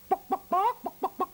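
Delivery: high, loud clucking hen. Pretend. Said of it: "There's a hen".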